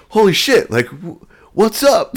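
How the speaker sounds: high, loud startled holy shit; mock astonished greeting; surprised exclaim. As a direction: voice jumps up in shock. Therapist surprised by his size.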